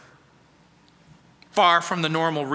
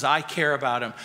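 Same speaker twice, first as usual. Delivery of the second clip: calm Jesus; 6 semitones lower, faster than usual. Acted. Jesus answers gently.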